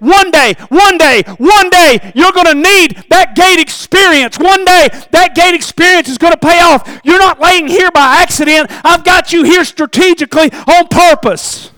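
high, loud insistent promise; God's promise. Acted. God speaking into Lazarus's heart, loud and insistent. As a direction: press the promise home, building on one day. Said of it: "God kept placing something in his heart saying".

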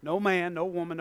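ordinary delivery

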